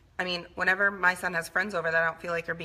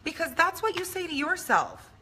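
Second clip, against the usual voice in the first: put-on much higher, defiant kid voice; imitating young self; 8 semitones higher than usual. Daughter voices her younger self arguing with mom, higher. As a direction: whine back like a stubborn girl. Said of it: "And I would say".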